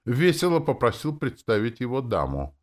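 plain reading voice

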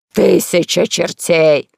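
slow, loud outburst; exclaiming. Acted. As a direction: bellow the curse slowly. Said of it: "воскликнул король".

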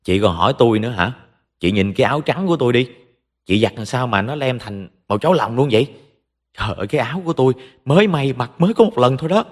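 fast, high-pitched furious complaint; angry screeching. A narrator's reading. Sister-in-law berates Hằng about a stained shirt, angry screeching. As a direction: rattle off the grievance in a high, irate voice. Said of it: "Nó tức giận ném thẳng vào mặt của Hằng rồi tru tréo".

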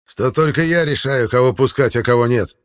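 loud, high, self-important creature; smug gatekeeper. Acted. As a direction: gloat over who gets in. Gatekeeper asserts his power.

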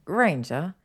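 ordinary delivery

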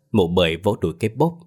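ordinary delivery, nothing marked

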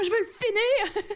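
hammed-up impatient player; mock impatience. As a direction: whine it slowly, laughing. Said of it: "T'es comme".

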